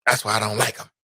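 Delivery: lightly voiced mock disapproval. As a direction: grumble it like a snooty stranger.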